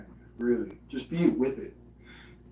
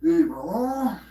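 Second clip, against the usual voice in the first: much higher put-on bro voice; goofy islander-style bro, pitched way up; character impression; 5 semitones higher, 4 dB louder than usual. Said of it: "impression of somebody with some fucking character".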